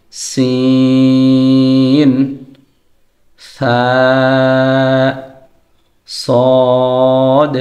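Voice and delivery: drawn-out সিন, সা and সদ; Arabic letter sounds. Shown as a demonstration. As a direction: sustain each letter name long. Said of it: "তিনটি হরফ".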